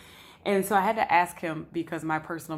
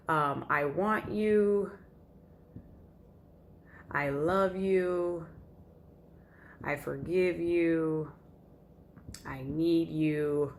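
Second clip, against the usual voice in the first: slow loving pleas; much slower than usual. Retold or imitated. Voicing loving side of heart.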